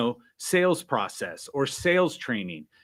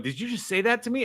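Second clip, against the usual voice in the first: higher, quick indignant "did you just say that"; mock offended disbelief; 9 semitones higher, 3 dB quieter, faster than usual. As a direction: play the colleague's shocked, offended inner voice. His brain reacting, offended.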